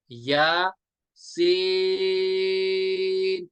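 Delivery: short "या", then "सीन" held very long; madd recitation. Shown as a demonstration. Teacher reciting Ya Seen for students, drawn out. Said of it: "सीन को तीन अलिफ".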